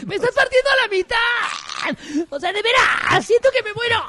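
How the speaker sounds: gemidos falsos muy agudos; gemidos exagerados. Pretend. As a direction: grita de placer en falsete. Continued mock moaning. (mujer gimiendo, exagerado y agudísimo)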